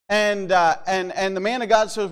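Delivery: usual speaking voice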